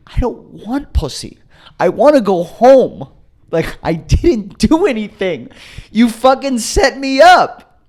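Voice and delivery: high, slow, mock-sobbing wail; tearful whining protest. Crying arrestee answers the cop, wailing in protest. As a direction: drag it out as a high crying complaint. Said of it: "are my sniffles, like, me crying".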